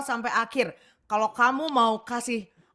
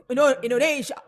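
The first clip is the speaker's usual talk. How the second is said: put-on Korean accent; 3 semitones higher, 5 dB louder than usual. Mock Korean pronunciation after "orang Korea.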